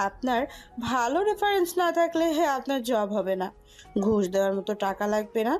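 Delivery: ordinary delivery, nothing marked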